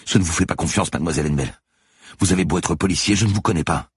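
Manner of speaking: agitated, distrustful protest; defiant distrust. A narrator's reading. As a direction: push back, refusing to trust. Manon refuses to trust the officer.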